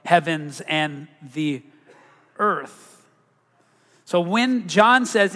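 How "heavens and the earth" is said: drawn out, each word weighted; slow scripture reading. Shown as a demonstration. Reading scripture slowly. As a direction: reads Genesis aloud to the congregation, slow and solemn. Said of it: "It says".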